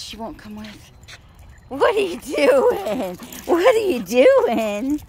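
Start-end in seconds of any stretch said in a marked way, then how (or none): 1.7-3.2 pet talk. Real, not acted. Talking to her dog. 3.5-5.0 louder pet talk. Real, not acted. Talking to her dog.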